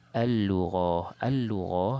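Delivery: "Al-Lughah" loud, with a long doubled L; into second lam. Shown as a demonstration. Teacher repeating for students, "Al-Lughah" with long L. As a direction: lean on the merged L in "Al-Lughah". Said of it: "kita masuk pada lam yang kedua".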